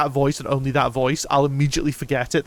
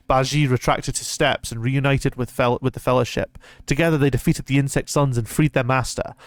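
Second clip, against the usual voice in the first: normal talking voice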